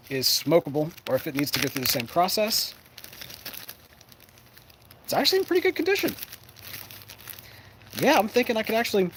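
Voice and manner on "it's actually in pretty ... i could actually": high, quick delighted surprise; pleasantly surprised. Real, not acted. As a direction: sound happily amazed. Surprised bag is in good shape.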